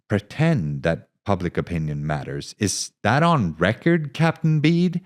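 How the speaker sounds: sly mock challenge; pointed jab. Reporter throws words back.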